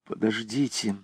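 choked. A narrator's reading. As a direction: force it out through a lump. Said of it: "сделала над собой усилие".

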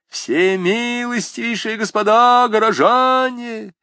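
loud, high, slow proclamation; shout to the crowd. A narrator's reading. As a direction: call out at full voice to a hall. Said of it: "крикнул он во весь голос толпе". (громкий призыв к толпе во весь голос)

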